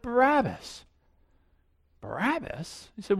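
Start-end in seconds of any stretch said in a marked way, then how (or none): 0.0-0.8 a mild mock shout of the name, pitched up; crowd shout. Crowd answering Pilate, a mild mock shout. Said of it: "they shouted". 2.0-2.9 soft, disbelieving question, falling away; incredulous echo. Retold or imitated. Pilate hearing the crowd's pick, quiet disbelief.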